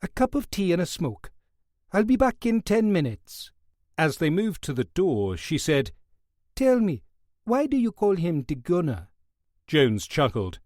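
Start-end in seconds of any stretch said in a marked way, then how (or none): 0.0-3.5 higher hesitant Welsh guard; a Welsh guard tempted but dutiful. Acted. 6.5-9.1 West Indian nurse. Acted. Nurse asks casually.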